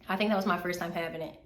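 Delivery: ordinary talk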